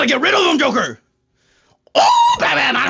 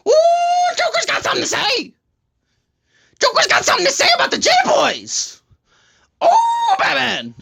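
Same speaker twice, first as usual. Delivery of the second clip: manic Joker; 11 semitones higher than usual. Acted. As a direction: wail and taunt Batman as a gleeful Joker.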